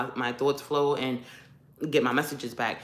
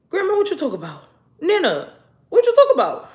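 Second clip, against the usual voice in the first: high-pitched, incredulous questioning, rising to loud; acted bewilderment; 13 semitones higher, 6 dB louder than usual. Granddaughter questioning grandmother about the uncle butterfly, acted bewilderment. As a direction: demand an answer in exaggerated high-voiced disbelief.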